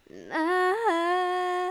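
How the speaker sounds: high, loud, long-held NUTS; hammed-up exclamation. Host marvelling at store-bought milk and butter, hammed-up drawn-out cry.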